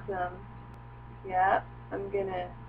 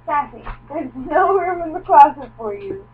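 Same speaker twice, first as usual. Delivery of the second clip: raised, high scolding of a pet; scolding the dog; 6 semitones higher, 12 dB louder than usual. Real, not acted. Owner scolding dog out of the closet, raised and high. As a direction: shoo the dog out, firm and loud.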